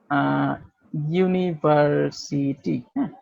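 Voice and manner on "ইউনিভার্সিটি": ইউনিভার্সিটি said slowly and softly, starting with a yoo sound; slow, soft model word with the yoo sound; careful university. Shown as a demonstration. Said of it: "এটার উচ্চারণ আ এর মতোও হয় নাই".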